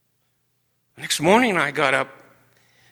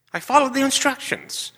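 much higher, stubborn indignant snap; defensive, raised-pitch insistence; indignant retort; 13 semitones higher than usual. Retold or imitated. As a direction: insist hotly that you followed the instructions. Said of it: "I was rude, I was mean".

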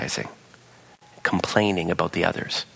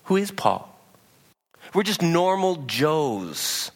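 paraphrased Paul; 7 semitones higher than usual. Retold or imitated. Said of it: "he goes on, he says".

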